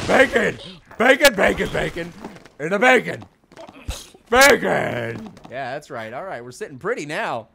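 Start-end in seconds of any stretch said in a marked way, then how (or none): 0.1-5.3 bacon yell. Acted. Yelling bacon catchphrase.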